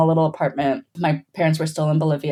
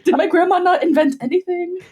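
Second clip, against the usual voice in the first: much higher, laughing disbelief; mock shock; 14 semitones higher than usual. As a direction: squeal it like a betrayed discovery. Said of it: "And I was like".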